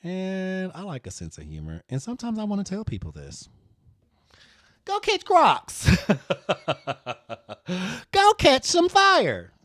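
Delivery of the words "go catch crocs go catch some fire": high, slow, loud sassy put-down; sassy telling off. Acted. Designer telling imagined people off, high and slow. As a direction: dismiss them with relish.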